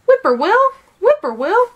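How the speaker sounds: high, loud, swooping birdcall; whippoorwill call. A narrator's reading. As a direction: repeat it like a bird calling, pitch dipping and leaping. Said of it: "calls the little gray bird".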